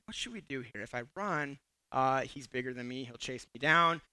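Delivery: low, very soft thinking aloud; hushed, acted-out inner monologue; inner deliberation. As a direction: mull it over under your voice. Retold thoughts in his head.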